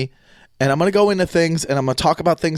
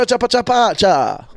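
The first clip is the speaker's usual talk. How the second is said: mock Italian gibberish; 8 semitones higher than usual. Host imitating his dad with fake Italian babble. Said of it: "He goes".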